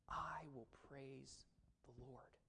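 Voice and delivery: slow, soft whisper of devotion; hushed whisper. Acted. David hiding in the cave, whispering his praise. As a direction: breathe the vow slowly, barely voiced.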